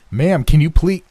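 loud, high-pitched, exasperated begging; desperate shouted plea. A narrator's reading. Man screaming at the unresponsive receptionist, desperate shouted plea. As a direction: shout to make her listen. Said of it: "screaming at her to please listen".

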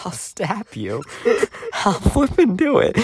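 playful fake menace from a sleepless grump; mock threat. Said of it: "But I'm not saying that".

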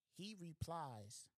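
drawn-out suspense; slow dramatic setup. Acted. Storyteller drags out the setup slowly before the punchline.

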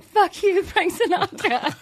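high-pitched mock yell; retold karaoke scream. Guest recalling a friend screaming in karaoke, mock scream. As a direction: yell it in comic outrage.

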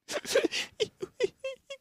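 high breathy mock giggle; high-pitched mock laugh; imitated giggle. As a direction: squeak out a girlish giggle. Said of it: "she's just going".

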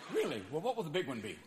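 normal talking voice

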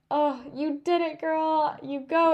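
high, excited congratulations; encouraging cheer. Retold or imitated. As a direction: imitate a friend urging a runner on. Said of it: "she was just so encouraging".

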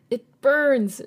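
choked out. A narrator's reading. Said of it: "she choked out".

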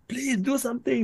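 high, soft desperate plea; pleading. Retold or imitated. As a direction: beg in a high voice. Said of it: "the one person will be like".

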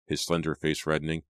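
plain reading voice

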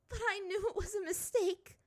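high, breaking tearful voice; faltering voice. A narrator's reading. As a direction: let the voice give way. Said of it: "Her voice faltered".

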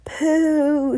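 high, loud, drawn-out pooh; screamed jeer. A narrator's reading. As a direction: cry it out long and high in disgust. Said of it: "screamed the audience".

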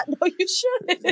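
laughing through the protest; laughing, slow and high. Real, not acted. Laughing at the anthem joke.

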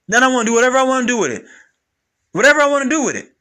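loud, high insistence; emphatic demand. Real, not acted. Man insisting on doing what he wants with his room, loud and high. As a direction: raise the voice and insist.

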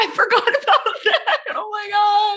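high laughing disbelief. Real, not acted. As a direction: laugh through the words at yourself. Laughing at own shock.

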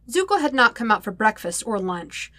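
plain reading voice

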